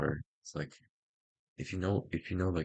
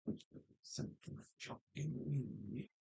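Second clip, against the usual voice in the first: high, slow creepy whisper; villain whisper; 11 semitones higher, 5 dB quieter, slower than usual. Pretend. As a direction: stretch it out like a cackling villain.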